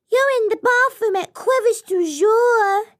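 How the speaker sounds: high, loud squeaky fish; tiny officious fish; small fish voice. Acted. Small fish character speaks.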